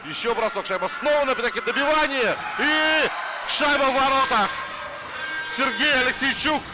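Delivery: rising to a shouted goal call; excited hockey goal call. Real, not acted. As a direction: build up and yell the goal.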